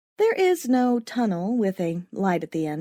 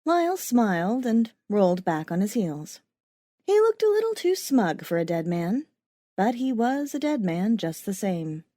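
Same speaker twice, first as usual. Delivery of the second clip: plain reading voice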